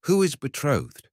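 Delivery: high, startled question; in affright. A narrator's reading. Barber asks in fright who is betrothed. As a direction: blurt it out in sudden alarm. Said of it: "asks in affright".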